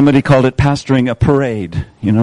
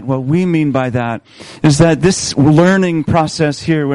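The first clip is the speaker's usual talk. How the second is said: ordinary talk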